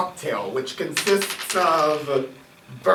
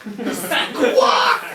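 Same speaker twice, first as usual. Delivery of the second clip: laughing parrot squawk; 4 semitones higher, 4 dB louder than usual. Acted.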